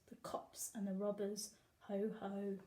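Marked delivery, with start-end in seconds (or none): none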